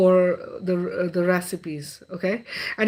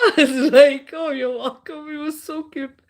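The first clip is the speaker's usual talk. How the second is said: laughing; 7 semitones higher, 4 dB louder than usual. Real, not acted. Laughing, retelling.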